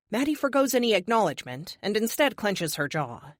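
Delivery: ordinary delivery, nothing marked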